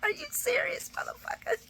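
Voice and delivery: whispered disbelief, hissed under the breath; stunned whispered disbelief; incredulous whisper. Retold or imitated. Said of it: "did you see Esme's face".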